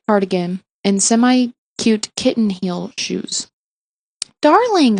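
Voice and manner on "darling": high, delighted Darling; mother greeting her daughter with delight; gushing Darling; gushing cry. A narrator's reading. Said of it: "her mother cried".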